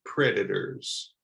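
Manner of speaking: "Predators" slow, middle T like a D; slow flap T prompt; model word. Shown as a demonstration. Teacher prompting learners to repeat "Predators". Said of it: "Try it out, ready?".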